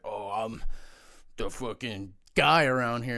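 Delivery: mock swagger, slow and drawn out; entitled big shot. Former player mimicking an entitled big shot, mock arrogant. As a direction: play a self-important guy demanding entry.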